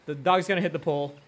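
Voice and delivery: usual speaking voice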